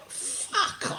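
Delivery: drawn-out whispered swear; retold whispered exasperation; stunned mock disbelief. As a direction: breathe it out high and soft, aghast. Stunned reaction to the line.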